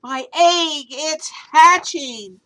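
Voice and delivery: loud, high, slow cry; joyful shout. Acted. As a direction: shout it with delight. Horton repeats joyful shout.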